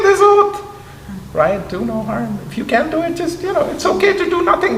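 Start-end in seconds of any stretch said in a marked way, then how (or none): 2.5-4.8 much higher, quicker; emphatic advice. Real, not acted. Professor urging educators to do no harm, much higher. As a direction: lift the voice high to stress the point.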